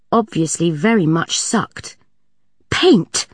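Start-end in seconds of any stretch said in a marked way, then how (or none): 2.7-3.3 high horrified cry; high appalled exclamation. A narrator's reading. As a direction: gasp at the discovery. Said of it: "absolutely horrified".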